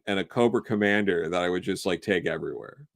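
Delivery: ordinary talk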